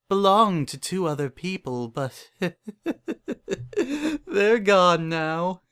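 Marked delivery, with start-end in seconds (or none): 2.4-3.7 a rising unhinged giggle, high and breathy; creepy laugh. Acted. Narrator chuckling darkly about the house's former owners. 3.8-5.6 slow and high, laughing through the words. Acted. Narrator giggling that the old owners are gone.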